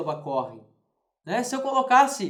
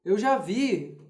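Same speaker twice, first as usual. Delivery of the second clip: mock objecting student; lightly voiced skeptic; imagined objection; 4 semitones higher, 4 dB louder, slower than usual. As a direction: voice a doubtful student. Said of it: "Alguém pode dizer assim".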